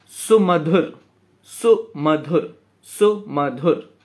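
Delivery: सुमधुर said slowly, each syllable drawn out. Shown as a demonstration. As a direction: stretch out सुमधुर to count its weight. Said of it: "इसका भी वजन वही है". (सुमधुर को धीरे-धीरे खींचकर)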